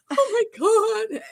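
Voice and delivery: high, slow crying wail; traumatized wail. Retold or imitated. Wife wailing to her mother about the spider. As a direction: moan it out, still shaken. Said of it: "so traumatized".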